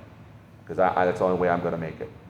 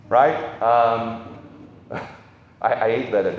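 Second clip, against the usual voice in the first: ordinary talk, nothing marked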